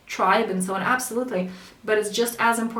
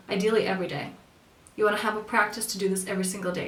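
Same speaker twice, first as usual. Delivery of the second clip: ordinary talk, nothing marked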